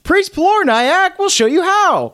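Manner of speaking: high voice. Pretend. Announcing in high voice.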